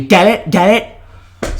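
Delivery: hammed-up nagging director; mock yelling. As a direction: badger the viewer with a mock shout. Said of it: "like Scorsese yelling at you".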